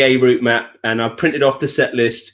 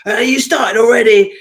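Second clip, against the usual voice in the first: high, agitated imitation of an impatient bride; wired bride; 6 semitones higher, 4 dB louder than usual. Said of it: "she's a bit wired".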